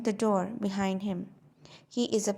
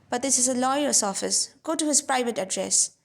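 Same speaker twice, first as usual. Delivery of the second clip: louder, indignant clerk's protest; outraged interest; 3 semitones higher, 6 dB louder than usual. A narrator's reading. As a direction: bristle with scandalised curiosity. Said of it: "with a sort of outraged interest".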